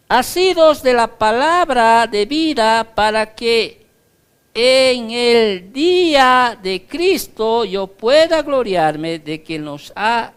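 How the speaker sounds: scripture reading. Acted. Reads Philippians aloud.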